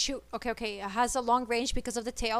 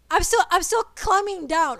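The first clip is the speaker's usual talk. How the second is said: higher, louder, panicky protest; frantic high climbing down; panicked; 7 semitones higher, 8 dB louder than usual. Real, not acted. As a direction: squeal it in alarm. Panicking while climbing in game.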